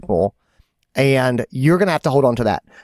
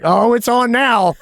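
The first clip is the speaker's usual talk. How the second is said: ready for a fight; 9 semitones higher, 3 dB louder than usual. Retold or imitated. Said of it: "I was like".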